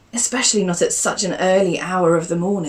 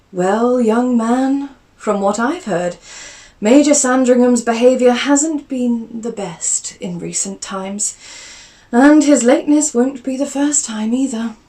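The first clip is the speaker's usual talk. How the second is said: a lady gently scolding; stern aunt; 6 semitones higher, 7 dB louder than usual. Acted. Aunt telling nephew the Major behaves badly, higher and louder.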